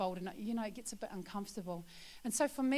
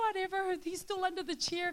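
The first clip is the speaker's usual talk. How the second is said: put-on kids scoffing; 10 semitones higher than usual.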